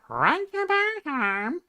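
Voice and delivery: very high gibberish dragon-dog voice; slow, squealing cartoon creature babble; Norval. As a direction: garble it like a talking cartoon dog. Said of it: "I can do the Norval voice".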